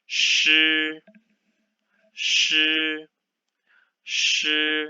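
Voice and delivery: shi held level and high as a first tone; teacher drilling shi, first tone; modelled Mandarin syllable. Shown as a demonstration. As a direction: stretch shi out flat and high. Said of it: "which one is the pinyin of".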